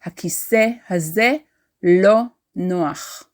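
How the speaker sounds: הכיסא הזה לא נוח fast and fluent; הכיסא הזה לא נוח quickly; native Hebrew. Shown as a demonstration. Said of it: "This chair is uncomfortable".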